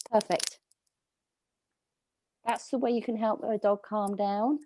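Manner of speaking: usual speaking voice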